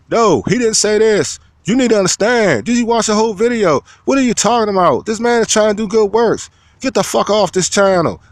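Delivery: high, indignant commenter voice he is imitating; mock heated commenter. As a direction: play the fan arguing hard in the comments. Voicing a troll defending him.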